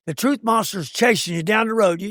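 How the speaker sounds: ordinary talk, nothing marked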